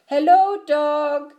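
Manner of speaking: high, loud hello; Duck calling out. A narrator's reading. Duck greets Dog while riding, calling out high. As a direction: raise the voice to hail a friend as Duck.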